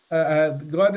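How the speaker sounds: usual speaking voice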